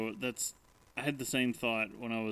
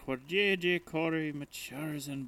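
slow, high, grand ad narrator; ad voiceover; 7 semitones higher, slower than usual. Pretend. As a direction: read it like a lofty commercial. Mock reading of brand copy.

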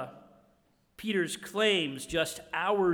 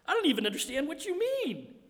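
much higher, fast, frantic brush-off; panicky denial; 12 semitones higher, 4 dB louder than usual. Retold or imitated. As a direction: squeak it out in a rush. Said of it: "he says".

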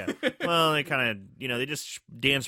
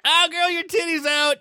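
put-on sassy girlfriend voice; 8 dB louder than usual. Casual imagined reaction.

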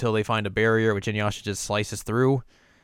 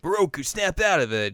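urging Moroku; 8 semitones higher than usual. Retold or imitated. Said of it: "is like".

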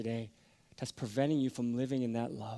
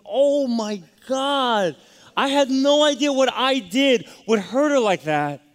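much higher and louder, voice straining with emotion; near tears; 12 semitones higher, 13 dB louder, slower than usual. Real, not acted. Preacher reliving his remorse to the congregation, high, loud and tearful. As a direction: cry out the remorse, slowly at first. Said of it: "And so I started crying".

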